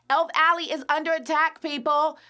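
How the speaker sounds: high, loud announcement of the attack; dramatic alarm. Acted. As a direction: cry it out like a town crier.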